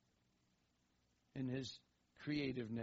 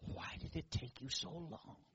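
tiny inner whisper; 7 dB quieter than usual. Retold or imitated. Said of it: "this little tiny voice inside".

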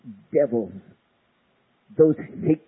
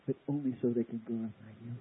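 quiet; 3 semitones lower, 11 dB quieter, faster than usual. Shown as a demonstration. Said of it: "repeated line".

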